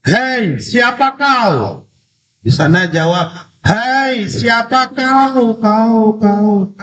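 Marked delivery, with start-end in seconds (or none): 0.1-1.9 high, long-drawn call into the distance; called-out question. A narrator's reading. Child calling to the unknown voice on the mountain, drawn-out call. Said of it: "Lalu anak ini bertanya". 3.6-6.7 high, stretched call with the last word repeating; imitated echo. Mountain answers the child's question, imitated echo. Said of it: "ada suara pantulan".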